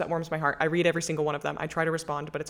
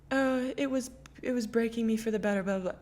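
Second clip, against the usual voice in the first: higher, sing-song fake upbeat voice; mocking toxic positivity, higher and airy; mock sappy positivity; 6 semitones higher than usual. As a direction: parody the silver-lining cliché. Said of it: "I'm not gonna sit here and be like".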